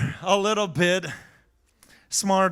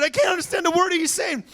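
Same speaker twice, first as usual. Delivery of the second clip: exasperated complaint; 8 semitones higher than usual. Retold or imitated. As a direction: blurt it out like he's had enough. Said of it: "He said".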